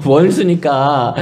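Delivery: 원수니까 stretched out very slowly, teasing; slow sarcastic repeat of 원수니까; mock drawn-out echo. Shown as a demonstration.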